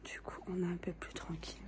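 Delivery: hushed whisper, barely voiced; library whisper. Real, not acted. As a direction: whisper so nobody hears you. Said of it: "Je parle tout bas". (chuchotement discret)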